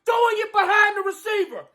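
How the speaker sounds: high, furious yelling at the quarterback; furious shout. Real, not acted. Fan raging at his quarterback, furious high shout. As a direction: scream it out, disgusted and enraged.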